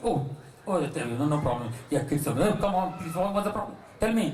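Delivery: low, gentle placating voice; calm him down sweetly; soothing wife. Acted. Wife comforting her shouting husband, low and soothing. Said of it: "wife comes".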